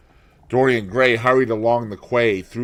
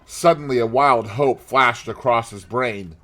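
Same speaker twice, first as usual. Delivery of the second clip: ordinary delivery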